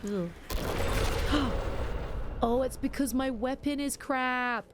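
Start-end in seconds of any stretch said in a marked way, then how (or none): 0.3-2.4 a sudden breathy gasp of shock; sharp gasp. Real, not acted. Streamer reacting to her character dying, sharp shocked gasp. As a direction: gasp at the screen as if caught off guard. Character just died in game.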